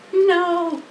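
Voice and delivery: a lightly voiced wise spider, patient; Charlotte voiced. A narrator's reading. Spider tells the pig he can't spin a web, lightly voiced.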